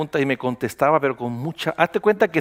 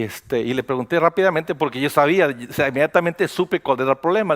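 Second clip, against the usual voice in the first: usual speaking voice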